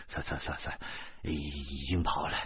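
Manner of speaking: low, soft stammered let-it-go; calm a worked-up friend down quietly; hushed, low restraining voice; placating. A narrator's reading. Narrator holds back angry friend.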